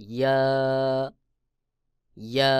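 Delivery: ইয়া short and clear from the middle of the tongue; letter demo. Shown as a demonstration. Teacher showing the second ইয়া to students. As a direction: say ইয়া crisply. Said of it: "লাগাইয়া".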